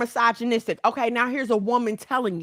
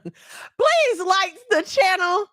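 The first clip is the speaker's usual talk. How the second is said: laughing plea; 6 semitones higher, much slower than usual. Real, not acted. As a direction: beg through the laughter.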